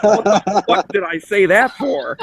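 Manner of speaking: mock self-exasperation; self-mocking disbelief. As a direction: whine it like a baffled fool. Mocking his own answer.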